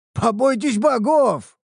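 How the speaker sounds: high, slow exclamation of shock; shocked gasp. Acted. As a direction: gasp at the price. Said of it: "ахнул Харан".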